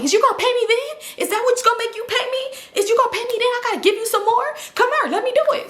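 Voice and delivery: high, insistent demand for payment; imitating Leslie pressing him to pay; Leslie pushing.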